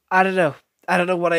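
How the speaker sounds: loud, high exasperated cry; frustrated loud I don't know; exasperated outburst. Real, not acted. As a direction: throw your hands up, loudly. Exasperated about the movie.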